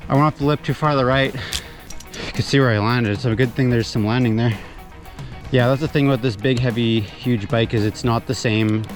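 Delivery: ordinary talk, nothing marked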